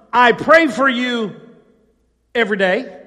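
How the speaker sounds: preacher voicing what Paul never said, loudly; preached mock quote. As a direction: boom it from the pulpit. Hypothetical Paul line, preached.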